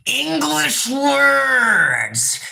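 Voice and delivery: high, very drawn-out complaint; exasperated wail. Real, not acted. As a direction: stretch ENGLISH WORDS into a long wail. Exasperated at viewer using English.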